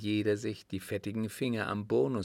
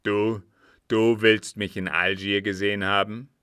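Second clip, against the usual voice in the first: loud, incredulous question; put-on surprise, louder; feigned astonishment; 9 dB louder than usual. A narrator's reading. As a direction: play the surprised man, challenging the witness. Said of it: "Er sah Sadi erstaunt an und fragte".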